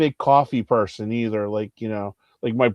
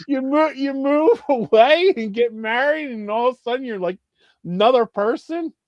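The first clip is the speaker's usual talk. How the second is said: high-pitched comic indignation; exasperated high-pitched griping; incredulous rant; 10 semitones higher than usual. Retold or imitated. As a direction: complain in disbelief, voice right up. Acted rant at changed brother.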